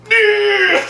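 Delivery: abrupt high, loud holler; mock villain cry. Actor ending every scene, sudden bellow. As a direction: randomly bellow it for a laugh. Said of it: "he'd just be like".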